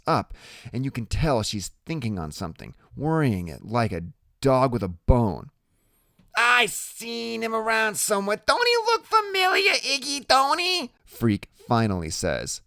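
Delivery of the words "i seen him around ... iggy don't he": squinty woman puzzling over a familiar face; squinty woman Loretta. A narrator's reading. Woman worrying at a memory, high-voiced and insistent.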